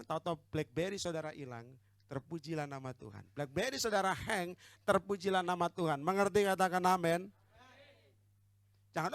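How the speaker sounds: normal talking voice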